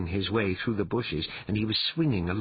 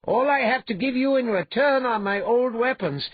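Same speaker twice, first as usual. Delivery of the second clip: high, loud, pleasant calling voice; mock-courteous hero; 13 semitones higher, 6 dB louder than usual. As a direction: call the polite farewell up to the brute. Hero's mock-courteous farewell to brute.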